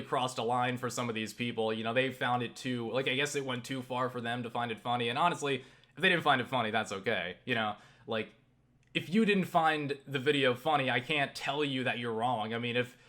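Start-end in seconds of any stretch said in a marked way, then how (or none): none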